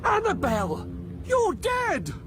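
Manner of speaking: very high, aghast cry; stunned, high-pitched exclamation; horrified shock. Acted. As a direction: blurt it out in alarm, pitch shooting up. Husband confronts his dead wife.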